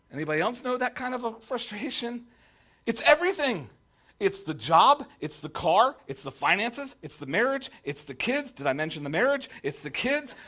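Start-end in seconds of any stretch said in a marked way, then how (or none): 2.9-10.4 higher, louder list of woes, climbing; building rant. Pretend. Preacher listing his own troubles, ranting louder and higher. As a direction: pile it on until you snap.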